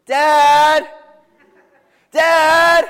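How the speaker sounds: full-throated high yell across the house; yelling for dad. Retold or imitated. Buddy calling for his dad from the hide-a-bed, loud high yell. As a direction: bellow it like a grown man calling for his dad. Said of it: "he just starts yelling".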